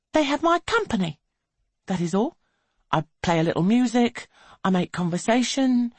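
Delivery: high, quick, airy courtesan; young woman voice. A narrator's reading. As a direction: breezily justify herself.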